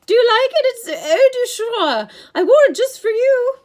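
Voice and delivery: loud, high, coy falsetto. A narrator's reading. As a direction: flirt in a false lady's high voice. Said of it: "Grover said in his falsetto voice".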